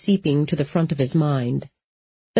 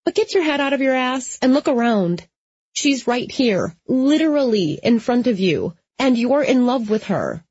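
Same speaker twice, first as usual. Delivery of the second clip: higher-pitched friend scolding; Molly, exasperated; 7 semitones higher than usual. A narrator's reading. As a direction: hammer the point home in a high character voice.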